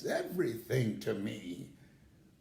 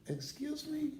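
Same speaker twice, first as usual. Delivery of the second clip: surprised excuse me; 12 semitones higher, 3 dB quieter than usual. Retold or imitated. Caught out, asks sister.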